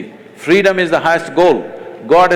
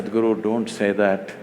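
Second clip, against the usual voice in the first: softer, lower imitation of hurt followers; mock hurt complaint; 6 semitones lower, 6 dB quieter than usual. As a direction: voice a mild, wounded plea in a hushed tone. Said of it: "people get very hurt".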